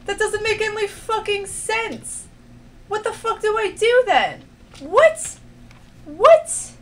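high, incredulous outburst; baffled protest, pitch way up; exasperated disbelief. Real, not acted. Baffled by failed puzzle.

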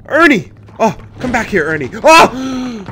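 loud desperate call. Real, not acted. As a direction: shout after him. Companion runs off.

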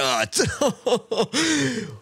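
high-pitched delighted laugh; gleeful laugh. Real, not acted. Celebrates a headshot.

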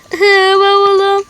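slow, whimpering sob; whiny sob. Acted. Girl still whimpering over her suitcase, tearful. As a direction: whimper it through tears.

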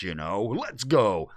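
normal talking voice

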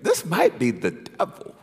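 a touch of mock alarm at the devil; hushed realization. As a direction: mutter it like a sudden suspicion. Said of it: "I had to say".

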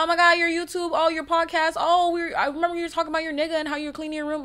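high, loud, rushed gushing; a fan rattling off everything she heard on the podcast, high and fast; overexcited fan. Retold or imitated. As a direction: babble fast like a fan who can't contain herself. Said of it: "Don't be like".

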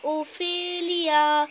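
long drawn-out distant call; calling from far away. A narrator's reading. A friend calling her name from afar, long and slow. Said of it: "hörte sie von weit her".

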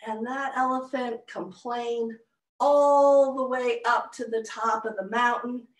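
ordinary delivery, nothing marked

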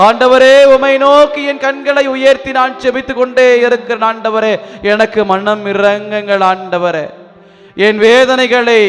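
ordinary delivery

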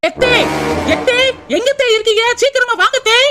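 high calling voice across the house. Acted. Daughter-in-law calls her mother-in-law to come, high call. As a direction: call her from the other room.

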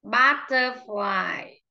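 Butterfly drawn out slowly for the class; slow classroom demo of Butterfly; slow model word. Shown as a demonstration. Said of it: "teacher models word stress".

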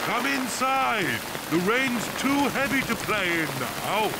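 calling out. Acted. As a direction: call them in from the rain. Father calls children in.

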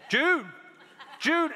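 high, loud repeated call. Retold or imitated. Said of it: "the closer I got, the louder I got".

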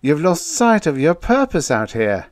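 high, loud admonishment; solemn. A narrator's reading. Officer reminding outpost man of his purpose, solemn. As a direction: admonish him weightily in a high voice. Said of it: "Murchison said solemnly".

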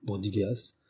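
plain reading voice

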